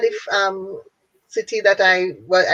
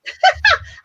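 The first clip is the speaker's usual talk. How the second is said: high laugh. Real, not acted. Laughs at setting up charity.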